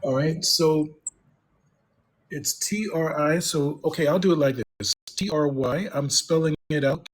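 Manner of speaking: normal talking voice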